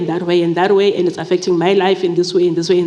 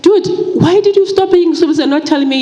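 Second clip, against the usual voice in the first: high and louder, exasperated at the ex; exasperated demand; 9 semitones higher, 3 dB louder than usual. Retold or imitated. Mother confronting the child's father over fees, high exasperated demand. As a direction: demand an answer, incredulous. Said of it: "And then I'm like".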